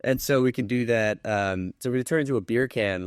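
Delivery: ordinary talk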